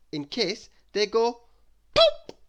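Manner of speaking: squeaky high burst for the pop; popping noise. A narrator's reading. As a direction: make the balloon go pop, high and loud. Balloon popping sound effect.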